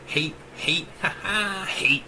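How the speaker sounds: a broken machine voice stuttering hate with a crazed laugh; malfunctioning computer muttering and tittering; glitching AI mumble. A narrator's reading. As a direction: let her sanity crack into a looping stammer. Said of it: "started to mumble".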